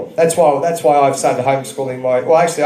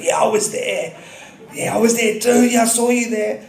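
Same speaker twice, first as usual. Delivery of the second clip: put-on kids' chatter; 6 semitones higher than usual. Kids chattering about rally.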